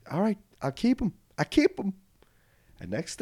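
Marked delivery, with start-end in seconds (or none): 0.1-1.9 lightly voiced bachelorette.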